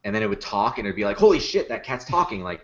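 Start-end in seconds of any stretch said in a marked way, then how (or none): 1.2-2.4 high, louder amazed exclamation; mock astonishment. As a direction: imitate a stunned viewer. Viewer reacts to talking cat.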